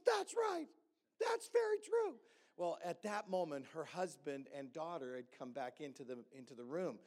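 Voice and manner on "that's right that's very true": excited agreement. Retold or imitated. Wife eagerly confirms the prophecy.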